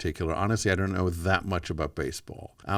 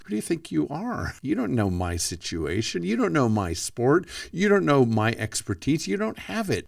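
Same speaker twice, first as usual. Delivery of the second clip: higher, indignant mock inner monologue; defensive inner voice; 7 semitones higher than usual. Ego flinching at advice, mock indignant and higher. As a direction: play the bristling ego talking back. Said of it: "the internal monologue starts".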